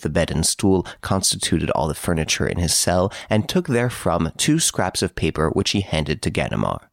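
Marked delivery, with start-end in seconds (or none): none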